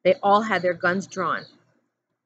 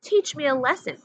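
challenging; 7 semitones higher than usual. A narrator's reading. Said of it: "he challenged".

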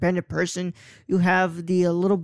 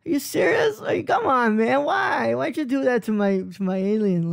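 higher, whining protest at his brother; playfully imitating a fed-up kid, pitched up; mock whiny kid; 5 semitones higher than usual. Elliot scolding his brother over the alien, high mock whine. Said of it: "He's like".

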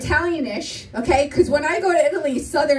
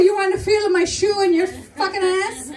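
raised, mock-angry imitation of dad; threatening father; 4 semitones higher than usual. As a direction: play an irate Italian father's threat. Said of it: "He's gonna ask you".

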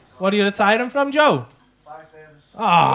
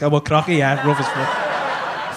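lower, slow, drawn-out mock-posh Crocky with a laugh; "Crocky" said lower and slowly, sending up Crosby airs; 10 semitones lower, 8 dB quieter, slower than usual. Teasing Crosby folk for acting rough, slow lower mock-posh voice. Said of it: "stop acting like".

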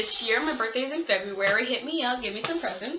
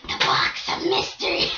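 spooky whisper; 6 dB louder than usual. Pretend. Dramatic reveal of mystery box.

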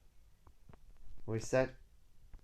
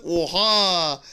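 shocked exclaim; 9 dB louder than usual. Real, not acted. Shocked at a game moment.

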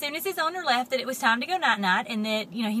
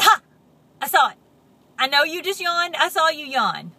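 triumphant; 5 semitones higher, 10 dB louder than usual. Acted. Catching pigeon yawning.

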